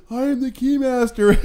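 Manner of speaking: high, loud, awkward Keymaster imitation; Louis Tully. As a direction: announce it high-pitched, like an awkward oddball. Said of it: "stands awkwardly, goes".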